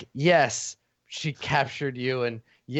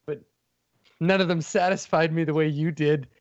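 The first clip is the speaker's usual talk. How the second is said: tearful little villain; 3 semitones higher than usual. Acted. Furry villain pleads with Eugene, close to tears. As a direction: beg him to understand, eyes full of tears.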